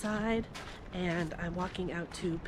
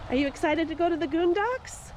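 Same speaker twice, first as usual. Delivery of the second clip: excited; 8 semitones higher, 3 dB louder than usual. Real, not acted. Asks the dog excitedly.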